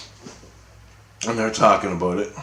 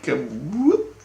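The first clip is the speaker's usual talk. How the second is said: ordinary talk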